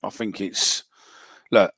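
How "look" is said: normal talking voice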